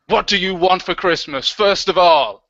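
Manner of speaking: normal talking voice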